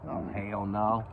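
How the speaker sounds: ordinary talk, nothing marked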